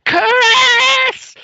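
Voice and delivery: high, loud shrieking Curtis; parodying the wife screaming her husband's name; mock shriek.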